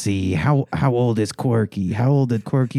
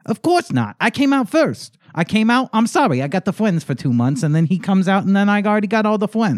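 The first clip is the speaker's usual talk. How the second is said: put-on higher indignant little boy; whiny kid; 8 semitones higher than usual. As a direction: protest like a spoiled toddler. Indignant child character.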